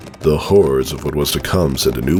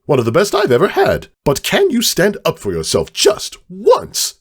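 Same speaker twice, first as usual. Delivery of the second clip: higher, pushy put-on voice with slowed stresses; blunt lecture; 8 semitones higher than usual. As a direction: scold a friend to toughen up, higher. Mac lectures Caramel.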